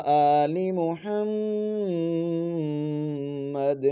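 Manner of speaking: slow, drawn-out Quranic chant; long melodic recitation of a prayer; recited blessing. Acted. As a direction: intone each word slowly and devoutly.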